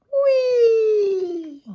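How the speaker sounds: very high, delighted squeal; excited playground whee; gleeful whee. A narrator's reading. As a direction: ride off giggling with glee. Can rides off happily.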